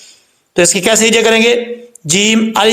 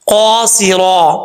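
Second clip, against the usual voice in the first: slow recitation; much slower than usual. Shown as a demonstration. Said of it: "teacher recites word slowly".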